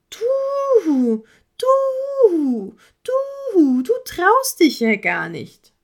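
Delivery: very high, loud stuttering owl taunt; mock him like a smug bird; teasing owl. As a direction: owl taunts the horse, high and slow at the end. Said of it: "neckte der Vogel weiter".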